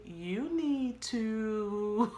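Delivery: each word stretched, mildly stern; retold stern order. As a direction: spell it out slowly, as God insisting. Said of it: "very stern about".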